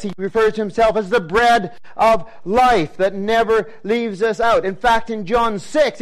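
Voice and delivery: rising, driving preacher's build with punched words; preaching at full force. Acted. Preacher rouses his congregation with a rising, pounding build. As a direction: hammer each point home to the congregation.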